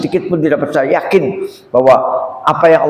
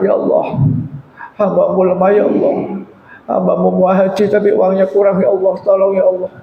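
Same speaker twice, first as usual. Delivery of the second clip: pleading prayer; 6 semitones higher than usual. Retold or imitated. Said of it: "Kemudian angkat tangan".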